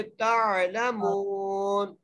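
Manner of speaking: very slow तालमून, meem held one alif long; stretched meem. Shown as a demonstration. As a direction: chant तालमून with a drawn-out meem. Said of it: "मीम को एक बार खींच के पढ़ेंगे".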